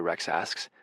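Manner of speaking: ordinary delivery, nothing marked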